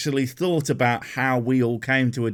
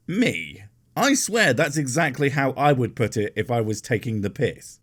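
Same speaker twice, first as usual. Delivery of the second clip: ordinary delivery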